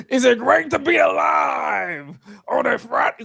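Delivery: high, drawn-out mock shout; hammed-up goofy crowd greeting, stretched high; goofy wrestler catchphrase. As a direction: holler it like a cheesy hype man. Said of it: "Punk goofy ass".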